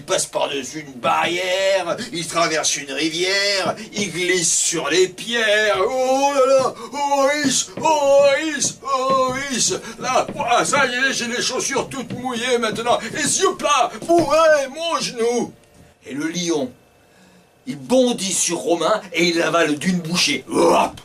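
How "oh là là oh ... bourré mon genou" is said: put-on higher, whiny boy with wet shoes; boy complaining.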